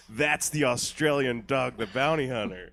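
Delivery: ordinary talk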